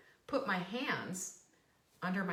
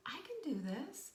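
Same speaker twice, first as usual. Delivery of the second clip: hushed pep talk to yourself; whispered self-talk; 6 semitones higher, 5 dB quieter than usual. Pretend.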